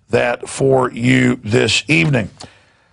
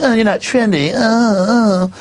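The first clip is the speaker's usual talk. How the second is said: mock trendy barista; 7 semitones higher, slower than usual. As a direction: ham up a preening barista. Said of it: "I'm like".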